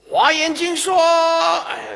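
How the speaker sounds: high, loud declamation, last word stretched; mock preaching. Lay Buddhist lecturing a dying person on scripture, mock declaiming.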